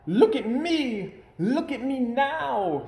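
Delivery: high, slow, proud showman; showing-off cat. A narrator's reading. Cat showing off his balancing act, high and slow. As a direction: show off with big stretched words. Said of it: "said the cat".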